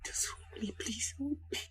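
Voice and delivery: whispered plea; soft plea. Acted. Wife asks her husband to hold her, whispering. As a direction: whisper it, longing to be held.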